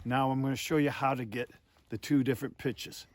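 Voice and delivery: usual speaking voice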